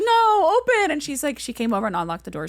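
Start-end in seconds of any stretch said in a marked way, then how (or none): 0.0-0.9 very high, loud no open; desperate plea. Retold or imitated. Stranded tourist begging the clerk to open, high desperate squeal. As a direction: squeal the plea through laughter.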